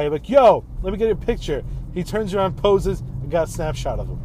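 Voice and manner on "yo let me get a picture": calling out loud across a crowd; retold yell. At a convention, yelling at a guy for a photo, loud call. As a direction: shout to grab a stranger's attention. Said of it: "and I yell at a guy".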